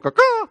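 high mock peacock caw. Preacher mocking the proud king as a peacock, high caw.

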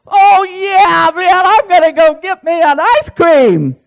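high, loud, bursting with happy relief; carefree joy. Retold or imitated. Preacher voicing a rested believer, high and giddy. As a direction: blurt it like a kid let off the hook. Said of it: "and you're like".

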